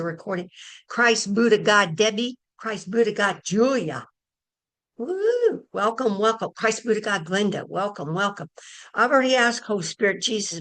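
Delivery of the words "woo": excited woo. Real, not acted. Greeting a newcomer joyfully.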